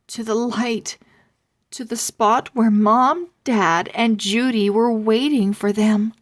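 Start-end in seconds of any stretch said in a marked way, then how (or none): none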